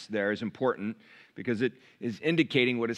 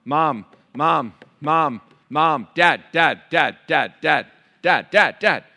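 put-on louder repeated child calling mom, dad; nagging child; 3 semitones higher, 9 dB louder, slower than usual. Child pestering a parent for attention, louder and insistent. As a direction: call for a parent over and over. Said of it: "every parent in here knows".

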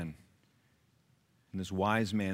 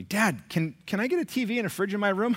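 put-on higher, quicker voice of an asking kid; imitating son; 9 semitones higher than usual. Son asking dad for a TV and fridge, imitated higher. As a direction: mimic your kid pleading for stuff. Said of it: "One of my sons said to me".

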